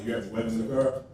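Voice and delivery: a deep, quiet police officer; cop voice. Retold or imitated. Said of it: "I get put over for a traffic ticket".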